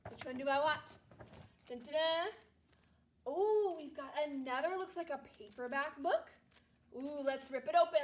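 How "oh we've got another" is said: usual speaking voice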